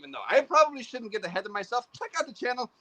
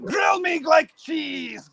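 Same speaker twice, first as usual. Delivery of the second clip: slow, louder hammed-up host voice; Dead Skeleton voice; 6 semitones higher, 5 dB louder, much slower than usual. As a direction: drag it out like a spooky host. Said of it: "my Dead Skeleton voice".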